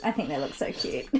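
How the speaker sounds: ordinary talk, nothing marked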